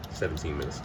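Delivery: low, soft aside; quiet self-correction. Real, not acted. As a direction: correct yourself quietly, under the voice. Corrects the cooking time to himself.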